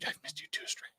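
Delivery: breathy whispered reply, no voice at all. Acted. Whispering back to returning viewer Stray. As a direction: whisper it like a secret to a friend.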